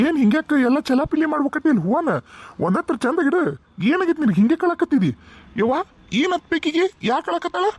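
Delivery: high-pitched, bewildered fussing; startled questioning. Acted. Brother finding scattered flowers and his sister crying, startled. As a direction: react in high-voiced dismay.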